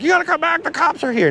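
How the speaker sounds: high, pinched mock panic; hammed-up high urgent plea; mock panicked bystander. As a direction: call him back in a squeaky fake fret. Voicing panicking people on beach.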